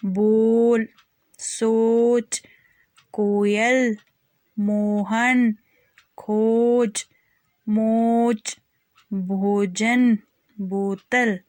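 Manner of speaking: each word said slowly and clearly, with a pause after it; word list drill. Shown as a demonstration. Teacher reading practice words for children to repeat, slow and clear. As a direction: model every word for the class to copy. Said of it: "एक बार और पढ़ते हैं।".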